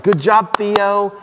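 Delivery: high, loud cheerful praise; bright praise. Retold or imitated. Jesus cheering on Theo with high, loud delight. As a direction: pump up the praise like a proud teacher.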